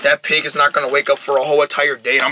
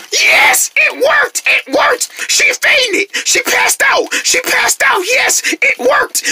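triumphant glee; much slower than usual. Acted. Spell works, triumphant cheering.

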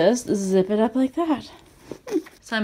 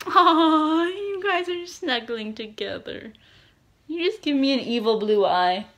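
high, drawn-out fond coo; cooing at pets; 5 semitones higher than usual. Real, not acted. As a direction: gush over snuggling dogs in a high voice. Cooing at snuggling dogs.

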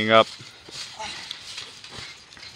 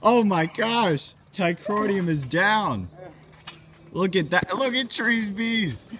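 amazed play-by-play; 6 semitones higher than usual. Acted. Commentator sees a man lifted, high stretched amazement. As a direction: stretch the words in awe.

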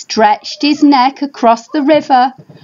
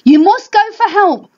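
urgent cry; 10 semitones higher than usual. Acted. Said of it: "cried the mother dinosaur".

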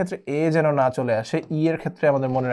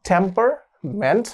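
slow drilled word; 5 semitones higher, much slower than usual. Shown as a demonstration. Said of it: "মাঝখানে একটা এ আছে".

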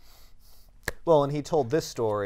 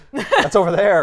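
said through a real laugh, higher and louder; 8 semitones higher, 9 dB louder, slower than usual. Real, not acted. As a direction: laugh the words out. Laughing during banter.

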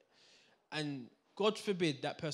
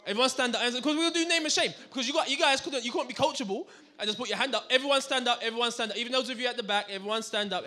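loud, high call to stand up; rally the audience, raised voice; loud commands; 7 semitones higher, 8 dB louder than usual. Real, not acted. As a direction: command the whole room to its feet. Speaker orders audience to stand.

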